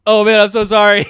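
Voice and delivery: loud, high, over-the-top apology; mortified apology. Retold or imitated. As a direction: blurt out a horrified sorry. Mortified apology on learning he's blind.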